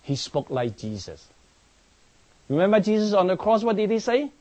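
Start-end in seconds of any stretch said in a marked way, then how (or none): 2.5-4.3 fervent call. Real, not acted. Preacher's fervent question.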